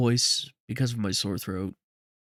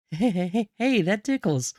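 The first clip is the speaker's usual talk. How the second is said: put-on high-pitched giggly kid; high little-kid voice reacting to tickles; tickled child; 7 semitones higher than usual. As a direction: squeal it lightly, high, as if tickled. Child licked by a dog.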